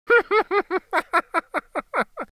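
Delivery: high chuckle; gleeful chuckle. A narrator's reading. Gingerbread boy chuckling at the fox, high chuckle. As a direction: chuckle to himself.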